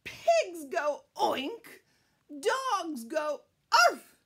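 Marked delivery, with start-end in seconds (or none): none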